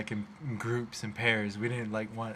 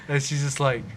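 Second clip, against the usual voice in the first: ordinary delivery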